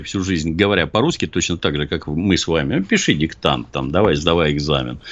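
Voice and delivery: ordinary delivery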